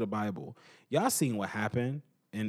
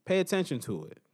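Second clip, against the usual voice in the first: ordinary talk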